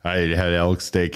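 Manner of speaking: ordinary talk, nothing marked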